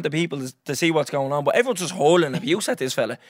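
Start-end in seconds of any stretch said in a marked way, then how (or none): none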